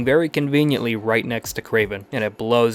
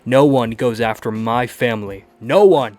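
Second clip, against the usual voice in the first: building to an enraged roar on the last words; loud, rising fury, ending in a yell; furious shout; 7 dB louder than usual. Acted. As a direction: protective rage, shouted at the enemy. Peter furious at beaten Kraven.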